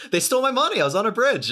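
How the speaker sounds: high, loud mock outrage; mock aggrieved. Podcast guest joking about bridge trolls, mock aggrieved.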